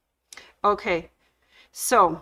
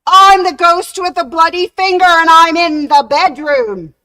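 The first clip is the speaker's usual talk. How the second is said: very loud, much higher ghostly howl; booming ghost wail; 8 semitones higher, 12 dB louder than usual. Acted. Ghost bursting into the bedroom, booming wail. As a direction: howl at full force to terrify him. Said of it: "the door creaked open".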